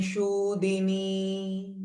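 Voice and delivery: Shudini with a long drawn-out shu, very slow and soft. Shown as a demonstration. Teacher stretching the vowel in Shudini for students. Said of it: "So only shu here is dirgha".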